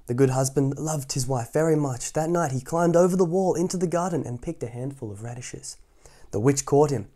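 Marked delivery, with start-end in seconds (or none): none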